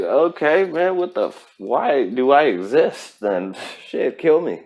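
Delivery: put-on a small kid giving up, half-joking; seven-year-old self. Seven-year-old looking up at the adult, mock despair. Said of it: "I'm, like, seven years old looking up like".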